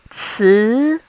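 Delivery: Ci gliding upward; second tone. Shown as a demonstration. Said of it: "this is how we mark the tones".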